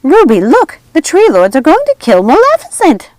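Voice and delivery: high, loud alarmed witch cry; cried out. A narrator's reading. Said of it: "Martha cried".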